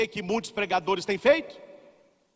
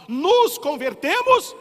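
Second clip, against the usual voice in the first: louder, slowed, higher peak; preaching climax; 9 semitones higher, 8 dB louder, much slower than usual. Acted. Preacher to congregation, rising fervent peak. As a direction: drive the word home slowly.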